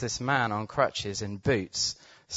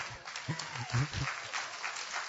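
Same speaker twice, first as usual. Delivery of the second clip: breathless laugh; 10 dB quieter than usual. Real, not acted. Laughing at the healing.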